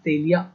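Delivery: "Telia" said as TAY-lee-ya; corrected pronunciation. Shown as a demonstration. Teacher correcting a misread name, "Telia" as TAY-lee-ya. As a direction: say "Telia" with a clear lee-ya ending. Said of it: "Maybe the correct pronunciation".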